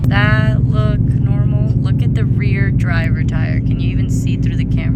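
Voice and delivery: over roaring wind; shouting over wind noise; loud wind noise. Real, not acted.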